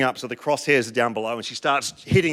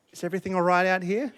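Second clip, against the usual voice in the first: gentle wife; 3 semitones higher, 3 dB quieter, faster than usual. Retold or imitated. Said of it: "in her beautiful way".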